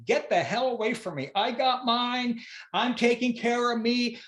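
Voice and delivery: selfish mock voice. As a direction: play the burned-out worker who only looks after himself. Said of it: "that looks a lot like this".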